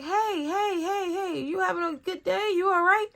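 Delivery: very high, coaxing greeting; sweetly high, mock-cautious hello; acted friendly check-in. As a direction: coax a moody coworker in a high voice. Acting out her friendly check-in.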